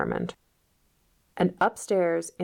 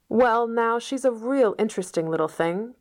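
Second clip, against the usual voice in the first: persistent; 6 semitones higher than usual. A narrator's reading. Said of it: "persisted Matthew".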